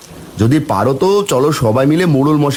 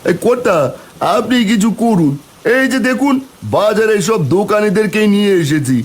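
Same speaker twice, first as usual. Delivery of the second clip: pleading, pitched higher and eager; urgent pleading; 7 semitones higher than usual. Acted. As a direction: beg the headman for help, urgently. Villager pleads with the headman.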